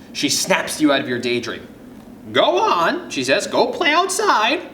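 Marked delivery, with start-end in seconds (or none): 2.3-3.1 put-on high-pitched grandma shooing a child along; grandma's high, lively urging; grandmother voice. 3.5-4.6 put-on high-pitched grandma shooing a child along; grandma's high, insistent send-off; grandmother voice.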